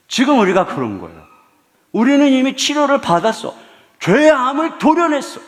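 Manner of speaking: triumphant preacher's shout; forceful preaching. Acted. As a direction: declare it to the congregation.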